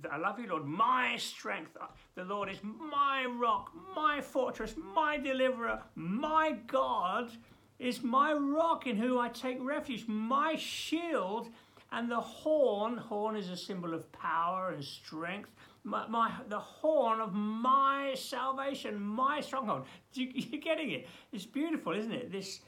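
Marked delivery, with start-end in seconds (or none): 0.1-1.9 loud, high, impassioned recitation of the psalm; ringing preacher's declamation; fervent psalm reading. Acted. 2.2-13.0 high, loud, proclaimed psalm; ringing declamation; loud first reading. Shown as a demonstration. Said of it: "I've gotta read that again". 13.0-19.9 loud, high, impassioned recitation of the psalm; ringing preacher's declamation; fervent psalm reading. Acted.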